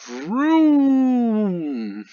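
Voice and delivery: high, loud vroom; playful engine sound; toy engine noise. Acted. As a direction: kid making car noises. Kids playing with toys.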